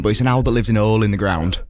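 ordinary delivery

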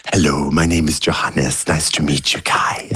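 put-on slow, careful South African accent. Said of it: "he says it in his South African Johannesburg accent".

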